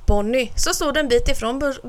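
ordinary delivery, nothing marked

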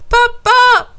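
high, loud cry for a parent; calling out. A narrator's reading. Alf calls his dad as water floods, calling out. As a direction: shout for help through the house. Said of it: "ropar Alf".

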